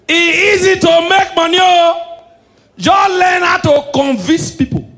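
high, loud, slow proclaiming; loud preachy punchline. Acted. Comedian to audience, loud proclaiming shout. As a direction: hammer the line home like a preacher. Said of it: "comedian preaching a punchline".

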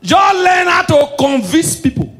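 high and loud through laughter; high, loud laughing punchline; laughing shout. Real, not acted.

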